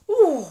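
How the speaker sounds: high loud ooh at the sting; loud pained ooh. Real, not acted. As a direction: wince out loud. Eyes stinging from onions.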